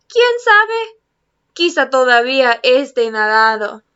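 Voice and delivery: storybook read-aloud switching Spanish and English; reading to children. Acted. Teacher reading Peter Pan aloud to her class, gentle storytelling. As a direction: read it like a bedtime story to kids.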